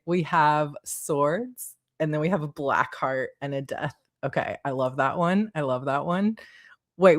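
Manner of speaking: ordinary talk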